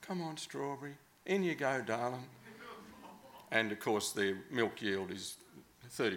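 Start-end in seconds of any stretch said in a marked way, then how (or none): 0.0-2.3 higher, softer, tender coaxing; gentle coaxing. Retold or imitated. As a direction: sweet-talk a cow into the bale. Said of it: "who whisper to their cows".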